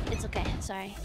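normal talking voice

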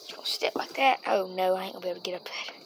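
fast breathy whisper at night. Real, not acted. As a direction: whisper so nobody wakes. Climbing car quietly at night.

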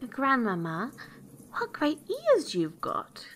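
put-on amazed little girl. A narrator's reading. Girl marvels at granny in bed, high and amazed. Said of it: "being greatly amazed to see".

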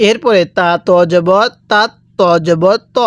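usual speaking voice

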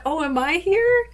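high, amazed little question; surprised. Retold or imitated. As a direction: sound stunned to be there. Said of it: "I was just like".

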